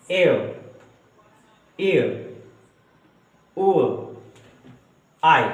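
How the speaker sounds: each diphthong said slowly on its own, with a pause after; diphthong sounds. Shown as a demonstration. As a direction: model the eight diphthong sounds one by one. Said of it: "जिनके sounds इस तरह से आएंगे".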